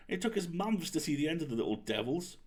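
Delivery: a hint of the aunt's exasperated fondness. Niece mimicking her aunt grumbling about runaway cookies. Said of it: "she always says".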